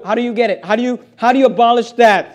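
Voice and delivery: ordinary delivery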